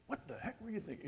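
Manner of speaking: high, fast mock scolding; scolding done badly. Adult reacting badly to a young person's mistake, mock scolding. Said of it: "we know how to do it badly".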